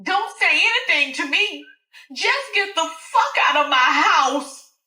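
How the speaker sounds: high, loud order to get out; furious Alice. Acted. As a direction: kick the friend out of her house. Alice throwing her out.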